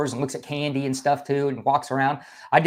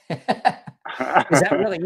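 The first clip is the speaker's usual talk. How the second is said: laughing; 3 semitones higher, much slower than usual. Real, not acted. Amused at the Russian demo.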